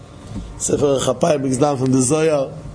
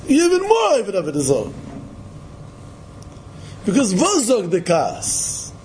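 normal talking voice

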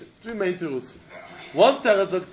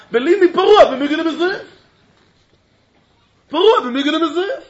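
pleading litigant; 4 semitones higher, 6 dB louder than usual. Acted. Litigant pleading his migu.

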